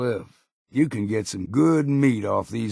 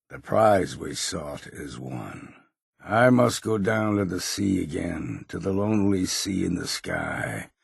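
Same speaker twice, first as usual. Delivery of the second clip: poem recital; 3 semitones lower than usual. Acted. Recites poems at a wrecked ship.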